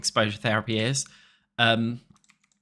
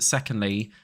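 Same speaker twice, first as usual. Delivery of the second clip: normal talking voice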